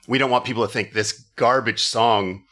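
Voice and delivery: usual speaking voice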